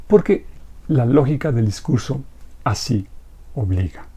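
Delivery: usual speaking voice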